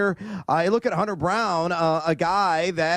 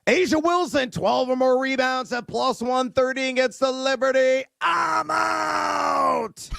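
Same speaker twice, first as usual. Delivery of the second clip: higher, shouted pick read; hyped sign-off; 7 semitones higher, slower than usual. Real, not acted. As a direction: shout the pick and drop the mic. Signing off with a pick.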